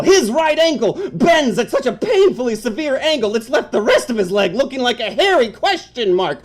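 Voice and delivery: ordinary talk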